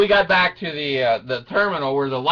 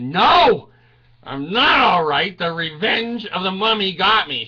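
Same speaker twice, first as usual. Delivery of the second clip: loud, raised comic exasperation; indignant denial; 4 semitones higher, 4 dB louder than usual. Acted. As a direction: burst out at a worried daughter. Indignant answer to daughter.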